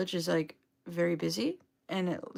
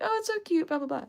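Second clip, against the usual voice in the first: mock gushing; 11 semitones higher, faster than usual. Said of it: "be like".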